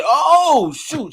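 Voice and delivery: high, loud yelp that drops away; mock cry at a sudden pinch; acted pained surprise. Acts out being pinched on back.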